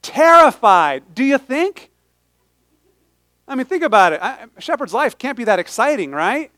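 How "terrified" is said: terrified stretched slow and loud; drawn-out terrified. Acted. Pastor drawing the word out with the congregation. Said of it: "say it with me".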